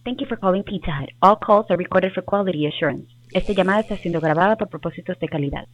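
phone menu voice. Real, not acted. Recorded Pizza Hut phone greeting.

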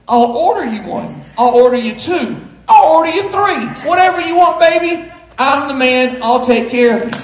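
mock big-spender bravado, rising with each offer; escalating generous offer. Husband grandly offering his wife hot dogs, escalating bravado.

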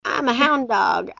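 ordinary delivery

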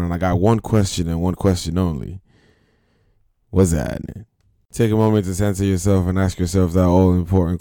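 loud, punchy radio host opener; show host intro. Acted. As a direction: hype the crowd like a show announcer. Opens his rant show.

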